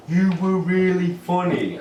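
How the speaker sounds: slow, over-careful "You were really funny"; slow mock American. Fan speaking slowly to the English comedian, mocking. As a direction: spell it out slowly as if to a foreigner. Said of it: "they have to speak slower for me".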